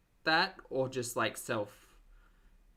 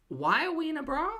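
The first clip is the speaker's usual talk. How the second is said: much higher, louder, baffled; incredulous; 6 dB louder than usual. Real, not acted. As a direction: ask in disbelief. Shocked by the video.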